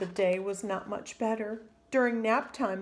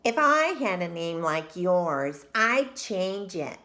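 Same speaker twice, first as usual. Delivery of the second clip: louder, slow, snide taunt; snooty taunt; 2 semitones lower, 5 dB louder than usual. Acted. Victoria taunts Chrysanthemum as they line up, snidely. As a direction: lean on it to sting.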